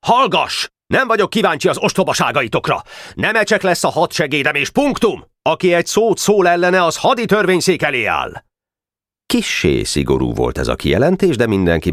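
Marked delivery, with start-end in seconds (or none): 0.0-8.4 magasabb, éles rendreutasítás; keményen leinteni, mint a vezér; rákiált. Acted. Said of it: "rákiáltott Leszikre". (haragos rákiáltás)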